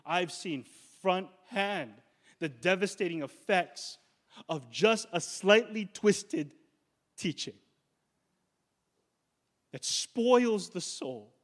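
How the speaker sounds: slow, weighty words, pressed hard, rising to loud. Real, not acted. Preacher to congregation about false teaching, slow punchy emphasis. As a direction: pastor driving home the devastating effects with punch. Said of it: "a little bit more punch in my tone tonight".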